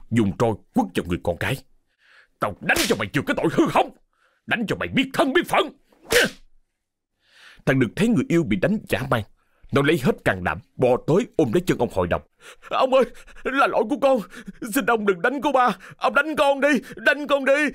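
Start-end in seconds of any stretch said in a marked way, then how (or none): 2.4-5.7 high, furious scolding mid-beating; heated, punishing outburst; raging father. A narrator's reading. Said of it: "đang trong cơn thịnh nộ". 12.7-17.7 high-pitched, anguished begging; servant's urgent, wailing plea; desperate pleading. Acted. Servant begs master to stop beating.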